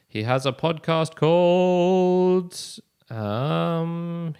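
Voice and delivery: loud, high, slow-motion lead-in; drawn-out stalling. Real, not acted. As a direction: stall for time by holding the last word. Stalls while searching for the name.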